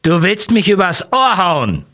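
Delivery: sudden high, loud accusing shout; angry shout. A narrator's reading. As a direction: yell it at him, outraged. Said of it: "Plötzlich ruft er".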